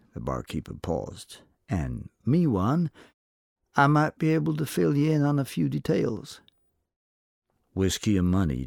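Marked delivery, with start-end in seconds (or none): 1.7-6.4 a sly saloon barkeep angling for a drink. A narrator's reading. Barkeep bargaining with the cowboy for gossip, sly. Barkeep's voiced character lines.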